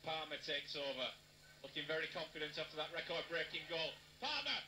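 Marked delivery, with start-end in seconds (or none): 4.2-4.6 high, punchy shout of the name; excited name call. Real, not acted. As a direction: call the scorer's name with joy. Commentator calls the scorer's name.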